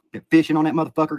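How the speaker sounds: ordinary talk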